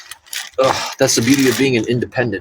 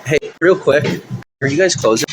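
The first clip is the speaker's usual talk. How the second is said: calling out; 9 semitones higher than usual. Real, not acted. Calls out to a shop worker.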